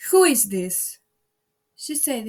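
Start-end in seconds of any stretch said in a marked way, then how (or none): 0.1-1.0 stern, loud demand from the queen; severe. A narrator's reading. Queen severely questions Alice before the procession, stern. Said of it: "the queen said severely".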